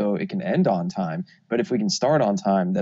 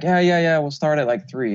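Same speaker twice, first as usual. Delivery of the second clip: eager pleading; 6 semitones higher, 5 dB louder than usual. Retold or imitated. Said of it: "when we're like".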